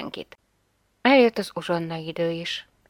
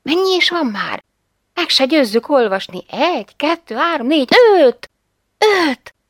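higher, louder, rising joy; excited counting; 8 semitones higher, 8 dB louder than usual. A narrator's reading. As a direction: count the coins with mounting glee. Mother counts coins excitedly. (izgatott, egyre hangosabb számolás)

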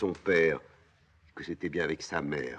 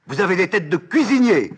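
high, loud scorn; shouted insult; 14 dB louder than usual. Acted. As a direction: rise to a furious, contemptuous shout. Antigone insulte Créon, qu'on veut taire. (insulte criée, avec mépris)

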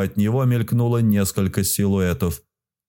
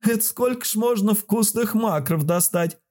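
very high squeaky little creature voice; joyful squeal; 12 semitones higher than usual. A narrator's reading. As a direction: squeal it out in delight. The spark keeps talking. (радостный писк маленького существа)

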